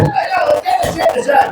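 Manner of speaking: high, quick mock cheer; fast sarcastic chore call. Homeowner sarcastically proposes putting it back. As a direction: rattle it off in mock enthusiasm. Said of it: "We didn't even use it".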